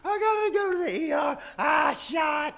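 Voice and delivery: put-on high, loud, panicked child wail; mock crying kid. Scared child dreading the shot, loud mock wail. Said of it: "usually crying like".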